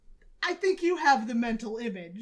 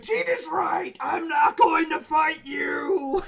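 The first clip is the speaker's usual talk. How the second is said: higher, slower mock shout cracking into laughter; retold yelling; 6 semitones higher, 6 dB louder than usual. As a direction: yell it while laughing at yourself. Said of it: "yelling at each other".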